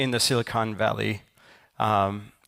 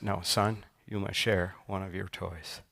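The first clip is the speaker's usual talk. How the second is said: low, softer, firm repeat of the order; a father who won't budge, said lower and softer; dad insisting quietly the second time; stern dad, quieter; 3 semitones lower, 7 dB quieter than usual. Retold or imitated. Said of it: "the dad looks at him and is like".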